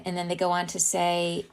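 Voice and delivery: ordinary talk, nothing marked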